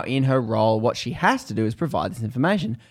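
normal talking voice

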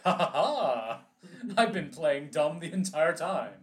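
loud gloating villain laugh; villain reveal. Pretend. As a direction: cackle like an unmasked mastermind. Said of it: "pull off the mask and be like".

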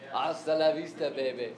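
a hint of a deadpan action hero; lightly voiced movie cyborg, baby dropped low; mock catchphrase for a laugh; movie tough-guy impression. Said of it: "he didn't quite say it like".

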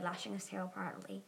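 ordinary delivery

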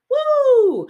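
high, loud whoop of pure fun; gleeful roller coaster cheer. Pretend. Coach imitating a thrilled rider, high loud whoop. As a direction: throw your hands up and cheer. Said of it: "having fun with your hands in the air".

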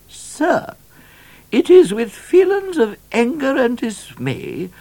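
very high, imperious royal voice, drawn out in places; Queen Victoria. A narrator's reading. Queen threatening to withdraw her patronage, haughty and high-pitched. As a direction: read a stern royal letter in a high, stately voice. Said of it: "The governor read the Queen's letter".

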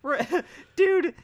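high, laughing exclamation. Real, not acted. Cracking up at a friend's presidential joke, laughing. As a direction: crack up while getting the words out.

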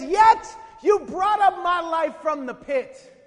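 loud, slow cry, pitched high; calling out. Acted. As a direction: cry it out from the depths, drawn out. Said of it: "He's calling out".

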